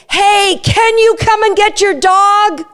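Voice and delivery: high, slow, cheery "Hey, can you come and get your dog?"; excitable cue. Shown as a demonstration. Owner sees a dog coming, cheery excitable cue. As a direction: keep it light and high so the dog stays happy. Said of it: "say it in an excitable tone".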